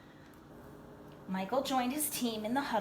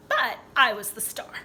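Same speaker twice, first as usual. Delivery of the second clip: proud boast; 9 dB louder than usual. A narrator's reading. Proud joke in the story.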